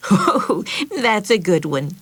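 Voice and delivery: mocking laugh then sneer; jeering laugh. Meg jeers at Betsy's made-up picnic, mocking laugh. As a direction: laugh at her little sister. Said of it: "jeered Meg".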